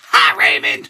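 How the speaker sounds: low, loud wrestler greeting; wrestler impression. Greeting a fan on request, low wrestler impression. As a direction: boom it out in a low put-on voice. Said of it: "say my name like Super Cena, Kane, and Roman Reigns".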